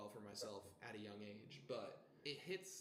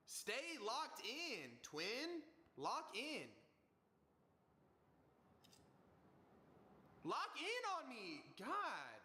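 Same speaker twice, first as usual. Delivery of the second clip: frustrated yelling; 11 semitones higher, slower than usual. Real, not acted. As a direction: snap at the camera that won't focus. Shouting at broken camera.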